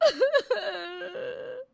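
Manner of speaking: high, loud put-on sobbing wail; wife wailing falsely to win her husband over; fake sob. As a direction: let out a crocodile-tear cry. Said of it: "बीवी के झूठे आंसू".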